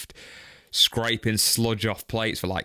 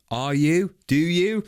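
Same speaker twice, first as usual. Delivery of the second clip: sceptical challenge; 8 semitones higher, 7 dB louder, slower than usual. Acted. Doubting the reader's claims.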